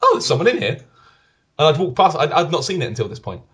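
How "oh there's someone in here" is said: surprised. Retold or imitated. Surprised at discovering someone.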